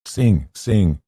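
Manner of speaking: amused, lightly lifted plea; laughing urging. A narrator's reading. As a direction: egg him on to sing. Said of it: "Theodora said, laughing".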